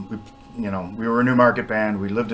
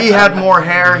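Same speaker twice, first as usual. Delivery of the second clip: excited recall; 8 semitones higher, 11 dB louder than usual. Retold or imitated. Replaying the moment of recognition.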